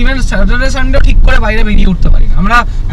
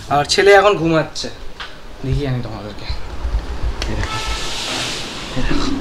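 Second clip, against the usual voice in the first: hushed and much softer, keeping it down; speaking softly; 4 semitones lower, 13 dB quieter than usual. Real, not acted. Vlogger beside his sleeping son, speaking hushed and soft. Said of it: "আস্তে আস্তে কথা বলছি সেই কারণে ছেলেটা ঘুমিয়ে নিক".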